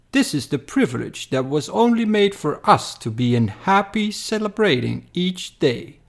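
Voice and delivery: entitled masters, sly and superior; scheming overlords. Acted. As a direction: purr it like greedy rulers plotting. Pleiadians speaking among themselves.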